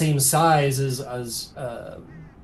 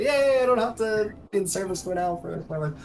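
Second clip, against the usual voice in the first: put-on higher-pitched happy kid voice; overjoyed child; 6 semitones higher than usual. Freed child jumps around, higher gleeful voice. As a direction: celebrate it like a freed youngster. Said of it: "he jumps around. He's like".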